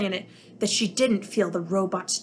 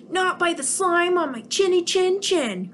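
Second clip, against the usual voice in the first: much higher defiant alien voice; Nick crying out; 9 semitones higher than usual. Acted. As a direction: stand firm in a squeaky voice. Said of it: "cried Nick".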